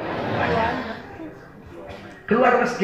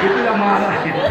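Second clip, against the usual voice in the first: usual speaking voice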